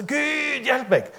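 høyt, bønnfallende rop; desperat rop til Gud. Retold or imitated. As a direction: rop om hjelp mot himmelen. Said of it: "Og jeg må si". (fortvilet rop om hjelp)

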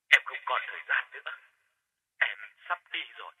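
quick, soft and fading away; urgent ghostly murmur; faint hurried voice. Acted. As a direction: rush it out as the line fades. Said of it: "giọng nói của ông Phát nhỏ lắm".

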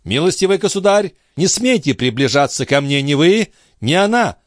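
high-pitched, alarmed command with little added volume; acted cry. A narrator's reading. The horrified princess orders the count to stay away, crying out. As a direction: cry out high, warding them off. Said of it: "закричала принцесса".

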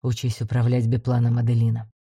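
low, quiet commander. A narrator's reading. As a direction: answer softly and low. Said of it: "тихо ответил Блайнер".

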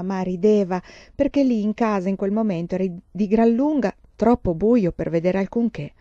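usual speaking voice

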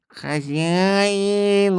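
high, drawn-out cat call; cat's call. A narrator's reading. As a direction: cry for his master. Cat calls from afar.

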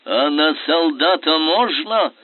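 slow, loud furious outburst; furious shout. A narrator's reading. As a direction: explode at the coward. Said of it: "вдруг бешено выкрикнул Скобелев".

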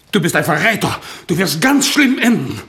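loud, rising angry threat; outraged accusation. Acted. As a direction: hurl it at a traitor. (empört und laut drohend)

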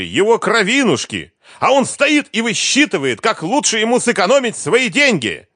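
high, loud, indignant rant; screaming tirade. A narrator's reading. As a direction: keep shrieking, appealing to a witness against him. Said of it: "закричала Лиза".

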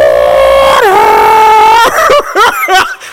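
high whooping laugh; loud shouted laugh. Real, not acted. Preacher laughing at his rooster joke, loud whoop. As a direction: burst out laughing.

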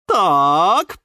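chatty caretaker. A narrator's reading. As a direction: fuss busily over your charges. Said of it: "не замолкала ни на минуту". (хлопотливая тётушка)

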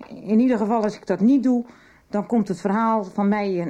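frightened woman blurting out the blackmail threat; agitated outburst. Acted. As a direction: push it out, scared of being exposed. Blackmail fear, pleading with therapist.

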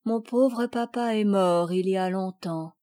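ordinary delivery, nothing marked

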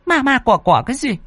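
high-pitched nagging wife; annoyed scolding. Acted. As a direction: scold the husband irritably in a high voice. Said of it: "nghe chồng nói như vậy thì bực mình". (bà vợ bực mình càu nhàu, giọng cao)

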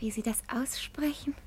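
breathy hushed whisper. Acted. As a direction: lean in and whisper it. Daughter whispers to the policeman.

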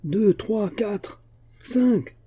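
high-pitched mounting count; excited counting. A narrator's reading. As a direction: call out each new sighting. Counting ships as they appear.

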